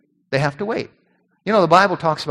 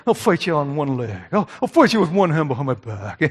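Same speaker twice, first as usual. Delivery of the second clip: low blustering tough-talk in a put-on voice; mock-tough character bragging; Cowardly Lion bluster; 3 semitones lower, 3 dB quieter than usual. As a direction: talk big like a blowhard picking a fight. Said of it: "he talks big".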